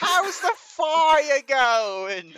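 loud, high, drawn-out mock British; badly faked British accent, stretched out slowly. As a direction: ham up a terrible British greeting. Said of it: "British listeners".

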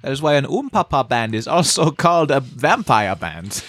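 hammed-up German accent; mock German accent. Immigrant explaining the vampire band, mock German accent. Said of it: "super racist accent".

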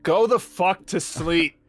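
high, slow yell across the room; an irritated cry from the other side of the room; shouted from across the room. Acted. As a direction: bark it across the dark room.

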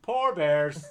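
high mock Chicago fan voice; Midwestern accent. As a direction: say it like a pitying Da Bears fan. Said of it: "like, a Midwestern accent".

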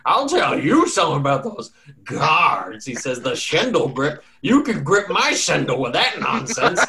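put-on loud slow old man's rant; hammed-up drunk old farmer rant; defiant rant. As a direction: rail at the guards, laughing. Old farmer ranting about guards.

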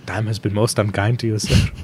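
low, lightly put-on Russian accent; makeshift Russian accent. Passport guy's line to a traveller, makeshift Russian accent. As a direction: attempt a Greek accent that comes out Russian. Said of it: "I just am doing, like, Russian".

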